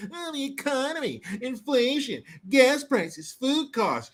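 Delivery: high-pitched whiny grumbling; mock complaining. Imitating people rumbling about prices, high mock whining. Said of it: "They're tired of hearing these rumblings".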